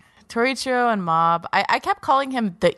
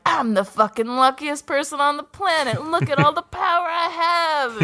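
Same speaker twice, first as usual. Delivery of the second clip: mock villain boast; 8 semitones higher than usual. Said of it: "Torichio disagrees and is like".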